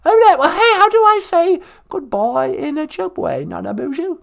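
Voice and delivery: high comic British granny; high-pitched royal curiosity; Queen Mum. Acted. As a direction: pipe up as a put-on old monarch. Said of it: "It's the Queen Mum".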